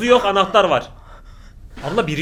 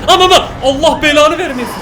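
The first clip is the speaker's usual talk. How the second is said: laughing shriek; 8 semitones higher, 10 dB louder than usual. Real, not acted. Jump scare in horror film.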